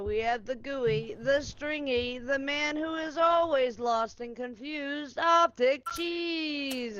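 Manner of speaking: drawn-out ring announcer; announcer intro. Acted. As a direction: build each name up slowly. Wrestling-style player introduction.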